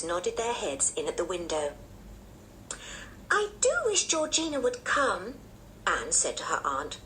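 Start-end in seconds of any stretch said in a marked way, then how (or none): 3.3-5.4 put-on high-pitched, wishful child voice; eager little girl. A narrator's reading. Anne longing to meet her cousin, eager high child voice. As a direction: wish it aloud, pitched high like a keen child.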